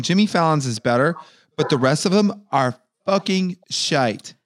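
ordinary delivery

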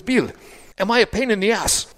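a hint of excited Frenchman, French accent; imitating a French friend's accent; mock French accent. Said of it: "I don't do accents well".

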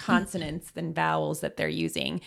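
ordinary talk, nothing marked

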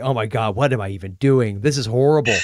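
deep, dropped self-mocking groan. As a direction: mutter it low, disgusted. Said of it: "I was like".

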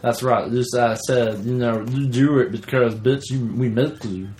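a hint of the cartoon Meatwad. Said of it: "Do Meatwad".

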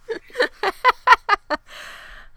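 high, loud laugh; burst of laughter. Real, not acted. Hosts joking about the bowling alley, loud high laugh. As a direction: crack up.

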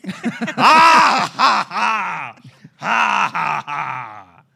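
loud, high hammed-up cackle; big shouted fake laugh, falling away; mock cackle. Imitating the character's endless laughing.

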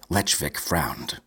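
ordinary delivery

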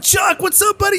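greeting Chuck as he walks in, shouting happily; loud greeting. Retold or imitated. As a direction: call out across the bar. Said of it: "I'm like".

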